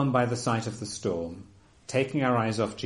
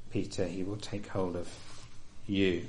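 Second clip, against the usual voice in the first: ordinary delivery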